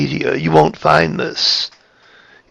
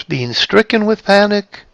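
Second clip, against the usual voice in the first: "being stricken with panic" high, held up to the end; light panic; 5 semitones higher than usual. Shown as a demonstration. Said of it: "express the difference between the two".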